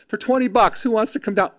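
high, mock sales appeal; imitating an infomercial host; pitchman. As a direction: tempt the crowd like a pitchman. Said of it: "pitch guy".

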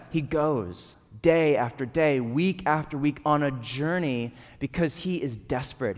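ordinary talk, nothing marked

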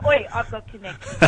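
a knowing cheeky mutter. Pretend. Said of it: "No, I'm kidding".

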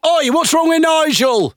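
very high, drawn-out mock heckle; imitated heckler. Heckler challenges the comedian about Nigel, high mock shout. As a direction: squawk it like a pub heckler, stretched out. Mimics heckler from played clip.

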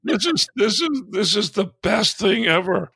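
high, gleeful and tickled; amused excitement. Real, not acted. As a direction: relish the absurd news. Gleeful about Vaporware news.

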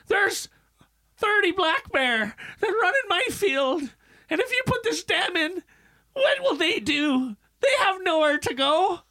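high, wailing imitation of a distraught woman; high hammed-up tearful plea; mock distraught. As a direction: whine it like she's about to cry. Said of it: "she's fucking distraught".

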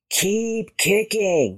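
slow, stretched call. A narrator's reading. Elephant to Rabbit struggling in the lake, calling out. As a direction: call out with drawn-out words. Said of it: "she called".

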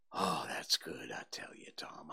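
hushed, unvoiced sigh of relief; breathy relief. Huck relieved it is Bull Harbison, whispering. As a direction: let it out in a near whisper. Boy's relief after fright.

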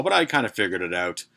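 usual speaking voice

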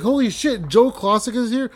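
high, loud retold amazement; starstruck excitement. Longtime fan hearing his legend is here, starstruck excitement. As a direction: blurt it out, thrilled and disbelieving.

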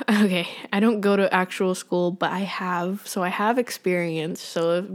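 ordinary delivery

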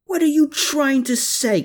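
high, raw furious howl. A narrator's reading. Klaus howls at Herman while grabbing his lapels, high and furious. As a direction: let the rage break out of him. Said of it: "Klaus howled".

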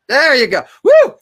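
loud high whoop of joy; yelling with delight as the screen is fixed; cheering. Real, not acted. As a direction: celebrate the fix with a whoop.

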